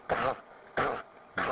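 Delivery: 嘎 pushed out hard on an airy, unvoiced release; forceful puff of air. Shown as a demonstration. Teacher demonstrating the third sound to learners, 嘎 forced out with breath. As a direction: say 嘎 with a forceful, audible burst of breath. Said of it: "让它用力出声音".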